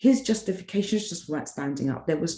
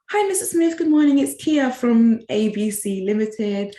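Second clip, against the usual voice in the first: bright, much higher, louder customer-service greeting; polite phone voice; 5 semitones higher, 7 dB louder than usual. Retold or imitated. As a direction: put on the sweet professional phone voice.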